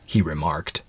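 usual speaking voice

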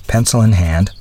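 usual speaking voice

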